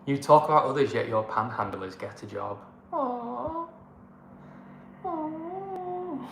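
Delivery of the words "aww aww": high, drawn-out pretend whimper; wounded little "aww", put on; long high mock-pitying moan; mock sad aww. Mock hurt at get-a-job comment.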